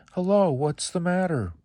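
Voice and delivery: put-on high, bright-eyed child's voice; a curious little boy asking kindly. Little boy asks the crying alligator, high and curious.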